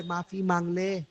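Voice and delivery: quiet pleading voice; soft call. Acted. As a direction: murmur the call to ask forgiveness. Quoted divine call, said softly.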